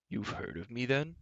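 soft giant's question, pitch sweeping up; eager. A narrator's reading. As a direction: ask with hushed eagerness, rising at the end. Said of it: "he asked eagerly".

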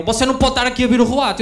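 put-on high, loud scolding old man; angry old man. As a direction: snap at him like a grumpy pensioner. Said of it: "vira-se outro velho para ele".

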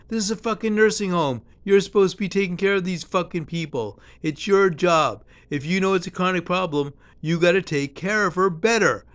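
high, loud angry tirade; indignant outburst. Retold or imitated. Speaker firing back at the nursing home staff, angry and loud.

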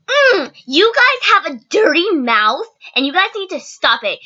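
high, drawn-out scolding imitation; mock freak-out. Bus driver scolding the kids, mock flipping out. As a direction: mimic an outraged telling-off, stretching the words. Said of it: "She flips the freak out".

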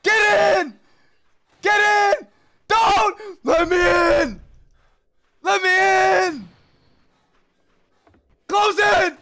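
high frantic yells to get inside; panicked shouting. Real, not acted. Gamer fleeing the monster, panicked shouting at teammates. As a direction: scream at the team to let you in.

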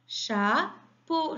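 "Chapeau" in two separate beats, cha... peau; syllable by syllable. Shown as a demonstration. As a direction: sound out "Chapeau" for children. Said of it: "la première ou bien la deuxième". (« Chapeau » découpé syllabe par syllabe)

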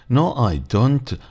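slow, high, careful teacher model of No, I don't; drawn-out No, I don't with every word clear; slow model answer. Shown as a demonstration. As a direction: spell out No, I don't slowly for the class. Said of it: "والسبب أن ذلك منفي".

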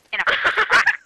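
high, loud squeal of delight; excited squeal. Real, not acted. Gamer squealing as teammate gets stuck. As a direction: squeal it out in glee.

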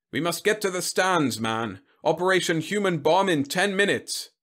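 high-pitched, urgent commander; blustering general. Acted. General hustling Whitlow to the stands, urgent. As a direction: announce it with brisk authority.

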